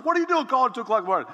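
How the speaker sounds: a hint of a half-asleep irritated dad; annoyed dad. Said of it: "He goes".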